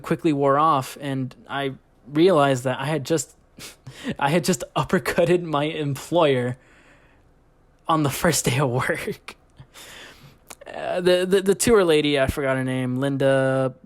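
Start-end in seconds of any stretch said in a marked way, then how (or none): none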